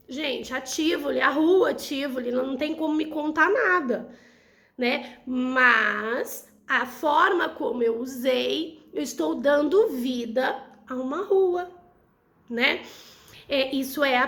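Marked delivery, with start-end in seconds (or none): none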